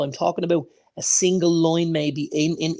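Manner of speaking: usual speaking voice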